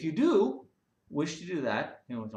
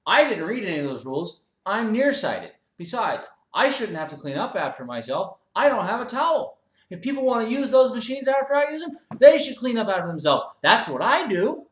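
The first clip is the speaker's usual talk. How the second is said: high, loud self-righteous whine; whiny gym member; 9 semitones higher, 11 dB louder than usual. Acted. Member defends his mess to the owner, loud high whine. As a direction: complain like a spoiled man-child. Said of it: "He goes".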